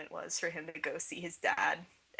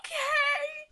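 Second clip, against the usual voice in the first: very high okay, half laugh half cry; 7 dB louder than usual. Real, not acted. Fan overwhelmed by sad scene, teary laughing okay. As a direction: squeak it out between laughing and crying. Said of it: "It's like".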